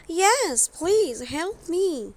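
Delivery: a hint of a small girl pleading; Thumbelina's voice. Thumbelina asking the fish for help, in a light girlish voice.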